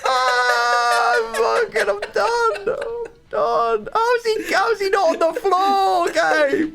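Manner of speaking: high anguished shout; wailing defeat. Real, not acted. Gamer wailing at his opponent, done with it. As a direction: give up in a wail. Losing badly, opponent laughing.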